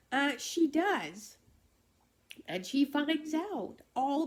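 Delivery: high, teary voice on the edge of crying; holding back tears. Real, not acted. Moved by her own story, speaking through real tears. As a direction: push through the story while tears well up. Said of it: "I didn't think I was gonna cry".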